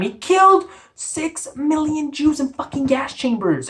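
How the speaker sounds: high, slow, appalled emphasis; outraged disbelief. Real, not acted. Host appalled anyone would quote Hitler, outraged.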